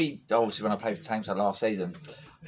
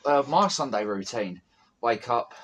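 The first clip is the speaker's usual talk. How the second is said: ordinary delivery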